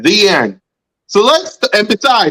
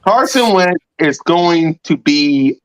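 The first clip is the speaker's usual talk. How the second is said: slow suspense; slower than usual. Real, not acted. Building suspense before the news.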